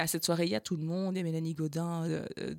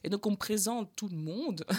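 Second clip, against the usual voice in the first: ordinary talk, nothing marked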